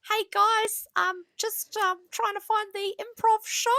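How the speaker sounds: high, lost, polite tourist; British tourist voice. Retold or imitated. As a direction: play a clueless visitor asking directions. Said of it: "gonna be like".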